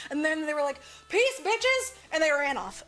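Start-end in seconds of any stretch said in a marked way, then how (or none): 1.1-1.9 mock high sign-off. Unicorns signing off cheekily.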